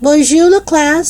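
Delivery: high, projected teacher's greeting; mildly loud, high French hello. A narrator's reading. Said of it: "loudly as she entered".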